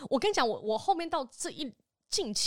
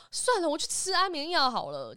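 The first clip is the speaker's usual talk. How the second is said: mock melodramatic mother; 6 semitones higher, 4 dB louder than usual. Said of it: "又接着说".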